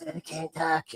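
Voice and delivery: soft, croaky mock strain; bruised larynx. Fan mimicking an injured wrestler, bruised larynx. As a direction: push it out as if the voice is gone. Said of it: "like, bruised larynx".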